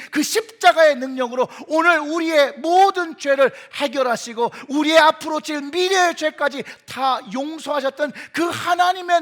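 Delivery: preaching at full force. Real, not acted. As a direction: drive each point home to the congregation. (힘주어 외치는 설교조)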